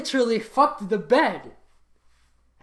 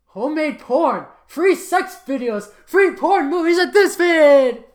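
loud, high hyped announcer; 9 semitones higher, 10 dB louder than usual. Acted. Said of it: "sound like an announcer".

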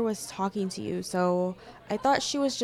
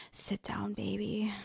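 whisper; 7 dB quieter than usual. Acted. Intimate whisper.

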